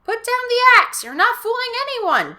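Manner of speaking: high, loud order; commanding shout. Acted. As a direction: order him to drop the axe. Dodger confronting axe man.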